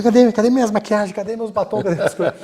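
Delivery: a hammed-up frantic lady hunting lipsticks; mock frantic woman. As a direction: whine like a woman who lost her makeup bag. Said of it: "parecia uma mulher que perdia o estojo das maquiagens".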